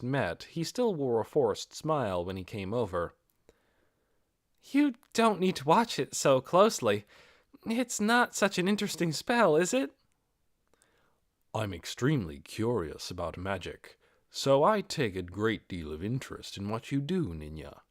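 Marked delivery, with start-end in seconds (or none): 4.6-10.0 brushing off attention with a nervous lift; modest young caster. Acted. Ninya telling Ainz not to watch, high modest voice. 11.5-17.8 polite interest, kept low and even; lightly voiced lord.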